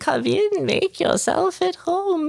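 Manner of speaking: very high, crooning witch voice; cackling old hag. Acted. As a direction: sweet-talk the cat like a creepy old hag. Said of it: "the woman next to it pets it and is like".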